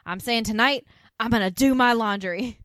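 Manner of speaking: loud, emphatic mock declaration; punchy, over-serious retold vow; adamant. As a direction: announce it with great conviction, acting out his stand. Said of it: "to be so adamant about".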